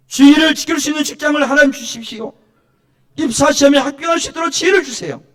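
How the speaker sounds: loud, high petition in prayer; fervent acted prayer, pitched high; crying out to God. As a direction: send each request up at full voice. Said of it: "하나님께 나와서 부르짖으라는 거예요".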